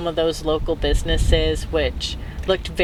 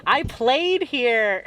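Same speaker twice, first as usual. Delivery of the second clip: excited self-quote; 6 semitones higher than usual. Retold or imitated. Recalling seeing Boston Common, excited higher-pitched gush. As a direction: gush it with a rising lilt, slowing on the last words.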